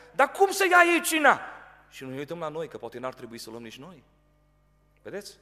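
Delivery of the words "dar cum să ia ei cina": much higher and louder, slow scandalized quote; mock indignation. Preacher mimics judgmental believers, mock scandalized. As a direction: ham up the gossip's outrage.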